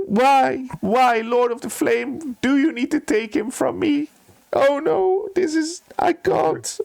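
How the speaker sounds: high-pitched hammed-up wailing sobs; grief-stricken wail, begging for help; crying and sobbing. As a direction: plead through tears in a high, desperate voice. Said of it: "he starts crying and sobbing and saying".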